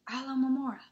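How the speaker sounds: plain reading voice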